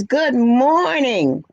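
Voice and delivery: "Good morning" stretched slowly, "Good" leaned on, falling at the end; drawn-out greeting. Real, not acted. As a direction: read the greeting out long and slow. Reading a viewer's chat greeting.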